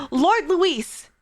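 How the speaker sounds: loud, high mock salute to a lord; hammed-up eager underling, dropping on the last word; Zorba. Streamer mocking a fawning game character, hammed-up servility. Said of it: "But that's basically him".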